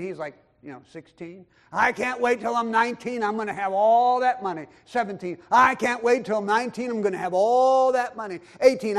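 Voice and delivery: normal talking voice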